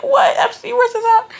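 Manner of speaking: high, puzzled voice for Sasha; playing the baffled friend; mock confused friend. Friend reacting to the news, in a high puzzled voice.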